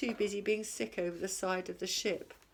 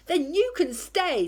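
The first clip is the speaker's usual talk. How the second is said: high, loud pirate voice; a captain granting the Troll a place; 9 semitones higher, 8 dB louder than usual. A narrator's reading. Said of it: "said Hank Chief".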